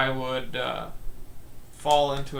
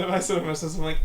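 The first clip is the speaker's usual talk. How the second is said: normal talking voice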